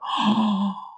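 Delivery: sharp mock gasp of astonishment; shocked gasp. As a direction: gasp as if startled by what you've heard. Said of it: "깜짝 놀라는 거야".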